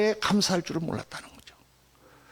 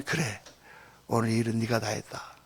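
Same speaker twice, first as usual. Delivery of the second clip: tender praise; 9 semitones lower, 6 dB quieter than usual. Acted. God voiced praising a servant.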